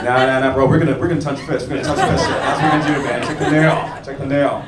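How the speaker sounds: normal talking voice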